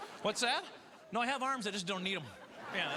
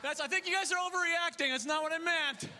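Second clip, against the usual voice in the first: mock panic; 11 semitones higher, 3 dB louder than usual. Panicked backpedal at airport security.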